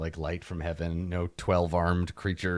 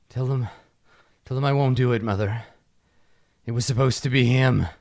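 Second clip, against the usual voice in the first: broken refusal rising to a shout; plead, then yell it out; distraught king; 5 dB louder than usual. Acted.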